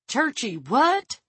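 high, slow, puzzled question; baffled. A narrator's reading. Guard fails to understand French phrase, baffled. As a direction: draw it out, utterly confused.

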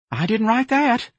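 very high, slow protest; frightened denial. A narrator's reading. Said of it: "His lips were quivering".

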